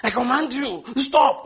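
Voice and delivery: high, loud, overblown order; hammed-up shouting at the elements; mock dramatic command. Showing how Jesus did not rebuke, mock dramatic command. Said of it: "It wasn't like".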